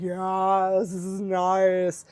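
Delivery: blissed-out, drawn-out contentment; stoned cat. Acted. Cat rolling in grass on catnip, stoned slow drawl. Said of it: "like, stoned".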